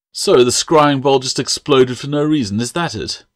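disbelieving scoff. Acted. Master scoffing at the apprentice's excuse. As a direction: sneer at the excuse. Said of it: "he scoffed".

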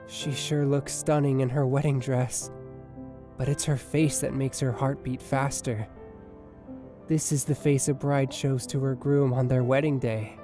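low, measured, loving voice; tender wedding vows. Acted. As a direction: pledge yourself to your bride, pausing between phrases. Groom speaks vows in dream.

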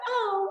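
high but weak, undersold yelp; a lazy, unconvincing ouch; half-hearted ow. Shown as a demonstration. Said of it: "it cannot be like".

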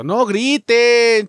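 loud, very slow drawn-out yell; pleading shout. Real, not acted. Gamer begging the game enemies not to raise the alarm, slow shout. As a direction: stretch every syllable as you beg.

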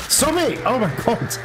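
high-pitched desperate stop it; panicked yell. Real, not acted. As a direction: yelp it in real panic. Panicked mid-fight against monster.

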